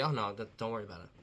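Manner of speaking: retold nonchalant backtrack; easy brush-off. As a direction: toss it off casually, secure and unbothered. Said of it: "so secure in himself".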